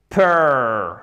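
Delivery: perfect split, long PER then fect; isolated syllable demo. Shown as a demonstration. Teacher shows the hidden R, perfect in two pieces. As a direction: draw out per in perfect, pause before fect. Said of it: "dans la prononciation de la syllabe".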